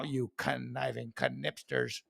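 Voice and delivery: lightly voiced airline announcement; mock announcement. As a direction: announce it like airline staff, hammed up. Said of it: "Or how about this?".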